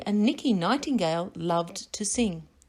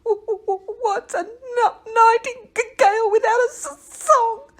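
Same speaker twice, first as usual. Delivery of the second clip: much higher, crying stammer getting louder; sobbing; 14 semitones higher, 8 dB louder than usual. Acted. Nicky sobs about losing his song, high crying stutter. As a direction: wail it through tears. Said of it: "was really sobbing".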